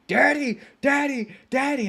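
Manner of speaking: put-on high, loud child's call of daddy. Son in the back seat calls his dad, high and loud. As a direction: whine daddy like an impatient little kid. Son calling while he's at limits.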